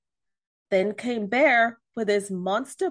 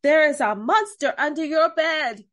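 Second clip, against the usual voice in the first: louder, higher storybook alarm; alarmed cry; 5 semitones higher, 5 dB louder than usual. A narrator's reading. Pig warning Duck of the monster under the bed, crying out. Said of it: "cried Pig".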